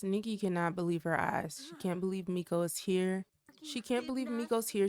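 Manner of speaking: normal talking voice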